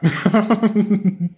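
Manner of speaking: big high burst of laughter; sudden loud high laugh. Real, not acted. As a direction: crack up loudly.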